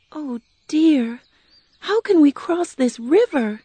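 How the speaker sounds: put-on high, girlish worried question; a young girl fretting at the water's edge; Dorothy's voice. A narrator's reading. Dorothy facing the river, asking in a high worried voice. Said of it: "Dorothy asked".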